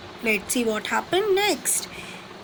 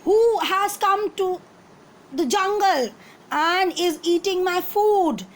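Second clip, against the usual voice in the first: angry; 5 semitones higher than usual. Acted. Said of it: "He was very angry".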